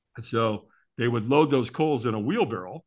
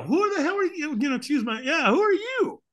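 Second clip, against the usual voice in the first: very high, incredulous challenge; hammed-up affronted questioning; indignant disbelief; 12 semitones higher than usual. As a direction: demand an explanation in a high, squeaky pitch. Acts indignation at the mysterious stranger.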